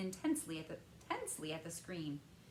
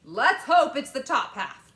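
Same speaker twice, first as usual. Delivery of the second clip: louder, higher wry father; grim father; 6 semitones higher, 16 dB louder than usual. A narrator's reading. Father making a grim joke, loud. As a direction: say it dryly. Mr TV voiced.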